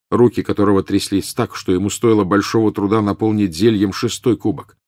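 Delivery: plain reading voice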